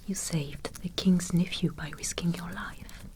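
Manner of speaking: ordinary talk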